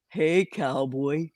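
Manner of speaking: slow, drawn-out imitation of a friend's hey cowboy; Jolly's greeting. Host half-heartedly imitates Jolly greeting a viewer, slow drawn hello. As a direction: lightly mimic her slow flirty greeting. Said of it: "as Jolly says".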